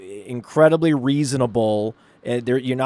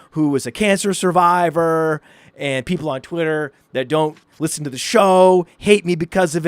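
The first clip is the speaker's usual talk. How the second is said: higher-pitched, eager rant building as it goes; excited rant; 5 semitones higher, 3 dB louder than usual. Retold or imitated. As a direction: gush on, retelling an excited pitch. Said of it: "and I'm excited".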